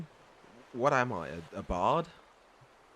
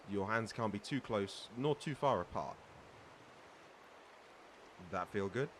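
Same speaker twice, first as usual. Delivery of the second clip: normal talking voice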